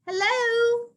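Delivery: high squeaky mouse hello; squeaky little mouse greeting. Acted. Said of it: "squeaks a mouse".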